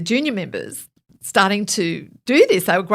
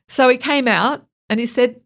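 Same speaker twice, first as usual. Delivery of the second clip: usual speaking voice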